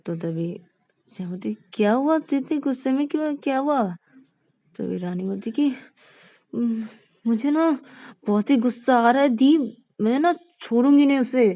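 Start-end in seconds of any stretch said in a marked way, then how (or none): 1.7-3.8 higher, quick worried questioning; high fretful sister voice; concerned elder sister. Acted. Sneha asks worried sister. 6.5-11.5 higher, louder furious vow; loud, high petulant rage; angry girl. Acted. Rani fuming about Vikas.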